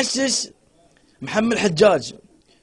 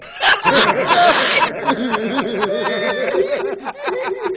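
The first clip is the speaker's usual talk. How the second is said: laughter; 5 semitones higher than usual. Real, not acted. Laughing at joke.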